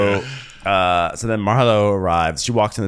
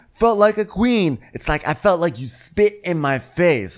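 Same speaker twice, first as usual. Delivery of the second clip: mock aggrieved retelling; 7 semitones higher than usual. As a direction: act out someone theatrically wounded. Retelling a cast member's aggrieved speech.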